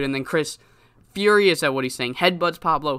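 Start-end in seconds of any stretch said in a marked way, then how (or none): none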